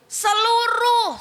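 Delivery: high, loud and slow, stretching the word Seluruh; drawn-out cry. Acted. Preacher stressing Seluruh to the church, slow loud cry. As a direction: hold Seluruh long and loud, falling at the end. Emphatic call to congregation.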